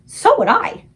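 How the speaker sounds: put-on very high, loud little-girl voice; Sophie. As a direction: blurt agreement. Sophie agreeing fearfully.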